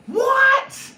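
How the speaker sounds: very high, loud shriek; mock astonishment. Speaker reacting to anger as a motivator, mock shock. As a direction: squawk it in exaggerated disbelief. Mock surprise at his own point.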